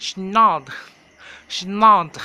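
loud "schnodd" as a made-up English word for pajamas, with a short clipped ending; mock English pronunciation. Shown as a demonstration. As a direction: shout "schnodd" like a teacher drilling a class. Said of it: "ชุดนอนนะคะ ภาษาอังกฤษค่ะ". (ตะโกนคำว่า "schnodd" เหมือนครูสอนออกเสียง)

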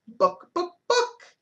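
squawking hen, last Book jumps high; playful chicken voice; hen clucking title. Pretend.

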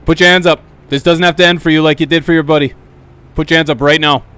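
loud, stern police orders; shouted commands. Acted. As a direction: bark the commands at the suspect. Cop commanding suspect.

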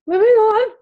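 high, loud sing-out to brush off tears; moving on. Real, not acted. Coach shaking off tears before moving on, high-pitched deflection. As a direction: push past emotion in a high, loud voice. Covering after getting teary.

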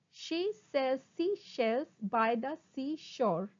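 slow, deliberate syllables; slow tongue twister. Shown as a demonstration. Teacher models a twister for students, slowly. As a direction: separate each word carefully. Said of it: "first try saying them slowly".

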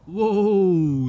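a loud, high whoa; real amazed whoa at the vanishing worm; surprised whoa. Real, not acted. Worm shoots away.

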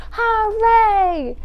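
high, loud drawn-out cheer; big storytime cheer; shouted hooray. Acted. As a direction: shout it out in a falling whoop. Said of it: "shout hooray".

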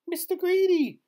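very high, loud terrified squeak; tiny man squeaking up at a giant; frightened squeak. A narrator's reading. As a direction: squeak your own name in fright. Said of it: "he squeaked".

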